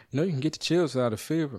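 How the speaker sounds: fast, sing-song mock of the repeated line; sarcastic nurse impression, hurried.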